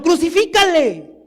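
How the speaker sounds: high cry with a falling end; imitated mob shout. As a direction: act out a crowd demanding execution. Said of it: "ahora estaban gritando".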